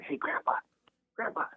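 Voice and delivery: hushed whisper to rouse someone gently. Retold or imitated. Grandson leaning over to his frail grandfather, whispered. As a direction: whisper it close to the ear. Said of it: "I leaned over and I whispered".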